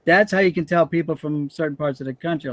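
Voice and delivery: ordinary talk, nothing marked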